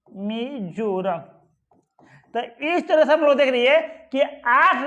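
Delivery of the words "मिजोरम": slow dictation. Shown as a demonstration. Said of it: "Manipur, sorry".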